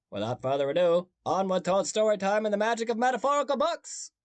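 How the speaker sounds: a hint of a Maine accent, rising high; weak stab at a Maine accent; attempted Maine accent. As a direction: try a Maine accent, bouncing up. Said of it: "I don't think that was a good Maine".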